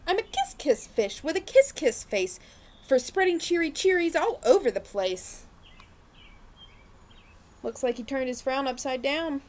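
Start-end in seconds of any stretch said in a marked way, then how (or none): 0.1-5.4 higher and a little louder, bright sing-song; kiss-kiss fish singing out his new cheerful refrain; cheery refrain. Acted. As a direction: lift the refrain into happy storytime cheer. Fish turns cheerful, refrain changed.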